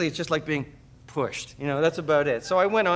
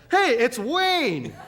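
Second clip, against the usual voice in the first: mocking paramedics; 8 semitones higher, 3 dB louder, much slower than usual. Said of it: "They went".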